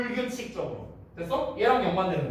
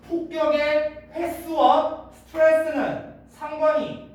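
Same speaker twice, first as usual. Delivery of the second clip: slow, high, louder emphasis on the key point; emphatic stress; 7 semitones higher, 4 dB louder, slower than usual. Shown as a demonstration. Teacher to students, driving home the main point slowly. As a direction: spell out each word, raised and slow. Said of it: "teacher stressing key point".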